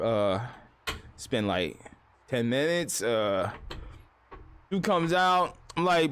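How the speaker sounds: ordinary talk, nothing marked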